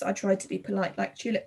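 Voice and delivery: usual speaking voice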